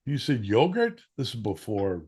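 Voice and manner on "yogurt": high, louder disbelieving rise; dad can't believe the idea; surprised question. Retold or imitated.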